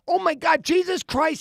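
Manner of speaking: very high, loud mock panic; freaking-out daughter. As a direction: imitate a preteen daughter freaking out. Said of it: "she is freaking out".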